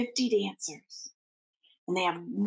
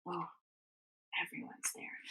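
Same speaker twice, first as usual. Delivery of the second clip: awed whisper; 6 semitones lower, 14 dB quieter, faster than usual. Acted. Reader to children, marveling at the gathered kingdom, whispering. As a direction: breathe it out in a hushed whisper.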